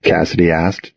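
usual speaking voice

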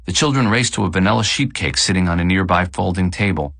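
usual speaking voice

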